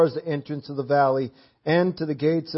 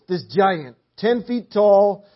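ordinary delivery

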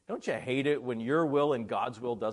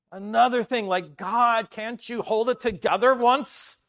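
louder, exasperated whine at God; exasperated complaint; 7 semitones higher, 7 dB louder, slower than usual. Retold or imitated. Preacher voicing the listener's frustration with God, exasperated. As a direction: complain like everything is breaking at once. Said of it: "Ever say that".

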